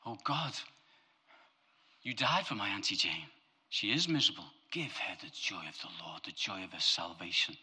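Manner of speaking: lightly hammed-up intercession; imitating a devout pray-er; mock earnest prayer. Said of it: "are we having a laugh?".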